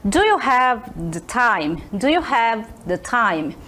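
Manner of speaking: slow, careful model question; slow clear question. Shown as a demonstration. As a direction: drill it slowly for the class. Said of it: "Pay attention". (pergunta lenta e clara)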